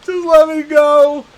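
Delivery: high, loud, slow desperate plea; begging for my life. Acted. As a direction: drag out each word, begging. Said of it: "starts pleading for his life".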